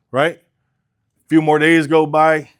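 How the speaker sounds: normal talking voice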